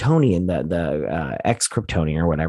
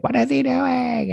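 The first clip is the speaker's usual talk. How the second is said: high, slow baffled; slower than usual. Retold or imitated. Acted baffled villain quote.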